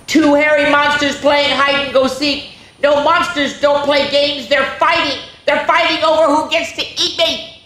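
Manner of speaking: panicking about monsters fighting; scared boy. Acted. Frightened boy in story.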